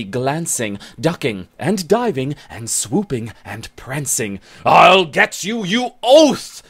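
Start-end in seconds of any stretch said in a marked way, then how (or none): 4.7-6.6 loud, high roared threat; roaring. Acted. Scot roaring at fleeing Bennet: loud high threat. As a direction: roar it after her as she flees. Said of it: "the ginger one roars".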